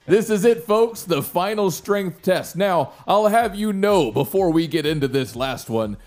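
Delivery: high, loud, slow ring announcer. Acted. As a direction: hype the crowd like a showman. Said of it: "You hear the announcer".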